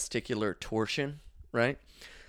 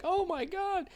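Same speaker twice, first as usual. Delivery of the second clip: a high "Oh my God" of disbelief; shocked, high-pitched reaction; mock shock; slower than usual. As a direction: gasp it out, way up high. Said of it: "I'm like".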